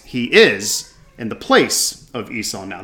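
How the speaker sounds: ordinary talk, nothing marked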